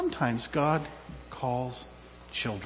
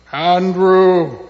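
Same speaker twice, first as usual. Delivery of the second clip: loud, drawn-out name call; long, loud acted summons; 11 dB louder than usual. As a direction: call the name to wake a sleeper. Imitates someone calling a sleeper.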